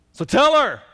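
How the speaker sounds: loud, high demand; Martha's demand. Acted. Martha telling Jesus to send her sister, loud demand. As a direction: insist that he send her help.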